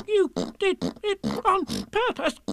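very high, slow choked squeak; spluttered little animal accusation; spluttering. Acted. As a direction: force each word out through soap. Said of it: "spluttered Piglet".

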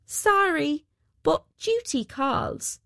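high, drawn-out parting call; sing-out farewell from the departing weaver; mild cry out. A narrator's reading. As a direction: call it out lightly, high and slow. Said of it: "cried out the weaver".

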